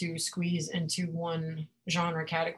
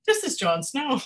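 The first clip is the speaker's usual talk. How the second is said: mock announcing, pitched higher; hammed-up royal introduction; Game of Thrones quote; 6 semitones higher, 3 dB louder than usual. Friend retelling a Game of Thrones scene, mock grand announcing.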